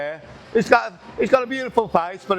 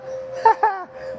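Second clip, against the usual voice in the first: nervous high laugh. Real, not acted. Nervous before a heavy lift.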